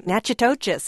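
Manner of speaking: Natchitoches said as spelled, NATCH-ih-TOE-chess; wrong pronunciation. Real, not acted. Host offering possible city names, mispronounced as spelled. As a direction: say Natchitoches letter by letter, ending in "chess". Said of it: "that's not right. It's wrong".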